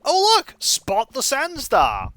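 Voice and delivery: excited catch. Acted. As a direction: shout the catch up high with glee. Voices game catch lines.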